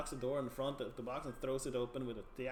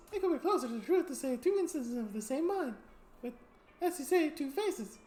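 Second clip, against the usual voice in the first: high, cheerful freak voice with a laugh; two-faced sideshow freak; 11 semitones higher, 4 dB louder than usual. Acted. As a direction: play the odd two-faced performer, amused.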